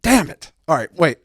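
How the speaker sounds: ordinary delivery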